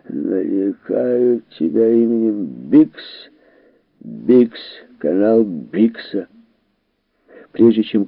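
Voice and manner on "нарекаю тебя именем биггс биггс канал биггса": put-on slow, slurred drunken naming; drunk slurring. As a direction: drag it out, tipsy and slurred. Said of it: "заплетающимся языком бормотал Биггс".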